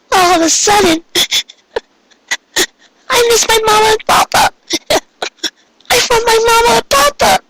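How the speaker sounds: put-on high, crying child voice; tearful. A narrator's reading. Pinkie weeps to Cupcake for her parents, high and tearful. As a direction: sob for mama and papa up high. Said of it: "tears began to fall".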